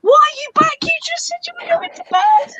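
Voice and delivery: high-pitched telling off; high scolding. Real, not acted. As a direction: scold the dog in a squeaky voice.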